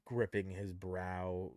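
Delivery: ordinary talk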